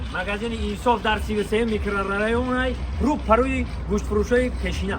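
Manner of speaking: loud, high market-trader sales pitch; bazaar merchant. Acted. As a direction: call out the sale like a bazaar trader. Shop ad announcement to viewers.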